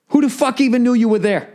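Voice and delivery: normal talking voice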